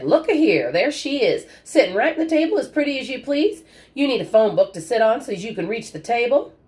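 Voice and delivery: put-on high, teasing old man's voice; a grandpa ribbing the little girl; gruff old grandfather. A narrator's reading. Grandfather teasing the girl at the dinner table, high and jovial. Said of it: "Grandfather Burke teasing at dinner".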